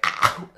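sudden imitated cry of pain; pained yelp. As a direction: let out an ow that can't be held in. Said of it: "I couldn't hide it in anymore".

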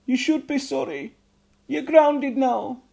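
a high, loud scolding mother's voice; strict goblin mother. A narrator's reading. Mother goblin telling off Ugnok, high loud scold. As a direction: scold him like a strict mum.

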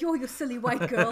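laughing mock voice of the amused landlord; amused Thai man. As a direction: tease her through a laugh. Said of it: "probably like".

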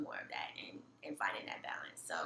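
soft breathy whisper. Real, not acted. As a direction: trail off into a whisper. Soft close of reflection.

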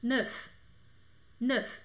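"Neuf" with a clear final F; neuf alone. Shown as a demonstration. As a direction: end "Neuf" crisply on F. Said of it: "só pronuncio ele quando ele tá sozinho".

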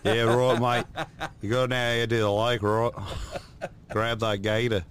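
hammed-up Australian accent. Shown as a demonstration. Telling a mate to grab that gator, mock Australian accent. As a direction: give a comic Aussie outdoorsman. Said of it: "how's Australian go".

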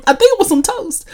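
high, loud, laughing delivery. Real, not acted. Host cracking up over a half-remembered film scene, laughing. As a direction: say it through a real burst of laughter.

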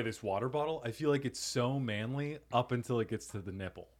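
ordinary talk

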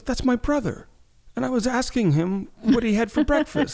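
high, puzzled retelling of the boss; imitating the boss. Retelling how the Ukrainian boss explained the yelling, puzzled and high. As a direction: play the boss, innocently puzzled. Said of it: "And she goes".

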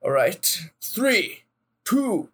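high, ringing call to the set; film director call. Pretend. Host calls the shot like director.

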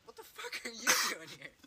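loudly acted-out "caught you" challenge; retold indignant demand. Sister confronts intruders in retold quote.

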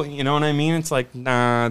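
ordinary talk, nothing marked

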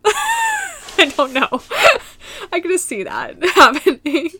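squealing high laugh through the words; laughing hard. Real, not acted. Vlogger losing it laughing at her own joke. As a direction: crack up mid-sentence.

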